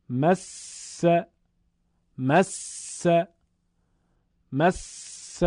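"مس" with the s held long and forceful before its vowel; emphatic doubled consonant. Shown as a demonstration. Quran teacher demonstrating tashdid, emphatic held س in "مس". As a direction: lean hard on the س in "مس", pausing on it. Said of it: "محکم، با شدت، با تأنی و کمی مکس".

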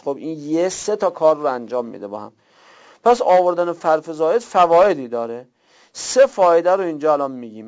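ordinary delivery

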